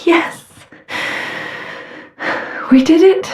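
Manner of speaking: high, breathy cry of victory; breathless triumph. Acted. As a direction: let out relieved, high-pitched elation. Triumph after a hard fight.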